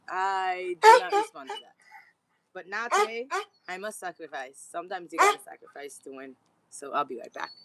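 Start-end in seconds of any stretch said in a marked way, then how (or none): 0.1-1.7 firm mock refusal. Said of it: "And I'm like".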